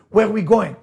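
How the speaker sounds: ordinary talk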